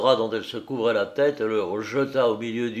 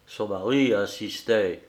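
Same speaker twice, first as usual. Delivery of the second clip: plain reading voice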